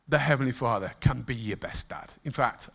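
ordinary talk, nothing marked

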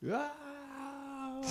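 long, high, hushed cheer; imitated crowd roar. Whole crowd greeting the star's arrival, high imitated roar. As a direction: hold a high mock cheer without full volume.

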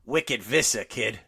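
put-on thick Boston accent punchline; South Boston accent. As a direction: say it like a Southie local. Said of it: "from South Boston".

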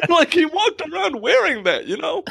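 talking through laughter; laughing. Real, not acted. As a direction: laugh while you say it. Laughing at teacher's badge photo.

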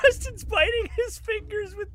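high, squeaky words through laughter; high-pitched laugh-talk. Real, not acted. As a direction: barely get it out for laughing. GM cracking up mid-sentence.

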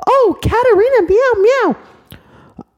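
put-on very high, loud childish squeal; excited little girl. Little girl gushing about Katarina Kitty, very high. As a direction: squeal it like a thrilled toddler.

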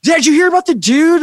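high-pitched imitation of buzzing crew; excited gossip. Festival runners gossiping about the dude, excited imitation.

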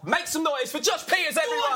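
high, stretched-out announcer build into a shout; rousing guest introduction. Real, not acted. Host brings out the guest for applause, rousing shouted introduction. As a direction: whip the audience up, voice climbing high.

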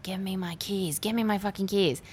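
held-back mock yelling; retold angry scream. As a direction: scream it under your breath, imitating an angry man. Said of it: "screams at her".

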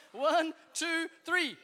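rally the crowd to go on three; count-in shouted to a big room; calling out the count. Real, not acted.

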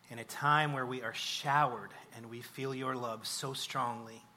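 earnest spoken prayer, fervent builds on the petitions. Acted. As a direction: pray aloud for the congregation, heartfelt and steady. Pastor leads closing prayer.

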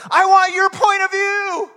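high, loud and slow, a pleading cry; voice of repentance. Retold or imitated. Preacher voicing repentance, loud high and slow plea. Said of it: "repentance says".

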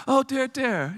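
badly faked Irish lilt; attempted Irish accent. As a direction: do a half-hearted Irish woman's "oh dear". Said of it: "I can't do an Irish accent".

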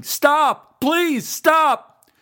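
high, loud tearful begging; sobbing cry to stop; crying plea. A narrator's reading. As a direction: beg them to stop through tears. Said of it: "Ron started crying".

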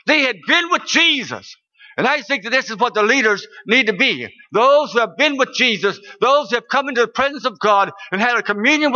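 high, emphatic sermon delivery; impassioned preaching. Real, not acted. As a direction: preach it with rising conviction. Preaches on a scripture.

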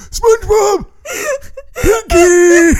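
laughing cartoon-voice yells; cartoon shout. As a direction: squeal the names like a cartoon.

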